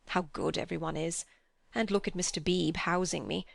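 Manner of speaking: ordinary delivery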